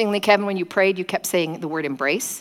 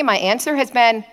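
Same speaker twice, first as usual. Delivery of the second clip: ordinary talk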